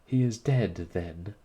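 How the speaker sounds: loud teasing exclamation; crying out. Acted. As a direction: cry it out, teasing. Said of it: "she cried".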